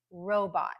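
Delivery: robot ending in a full, clear BAHT; careful robot, strong RO, clear bot; clear short O. Shown as a demonstration. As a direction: keep the second vowel of robot unreduced. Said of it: "very clear short O at the end".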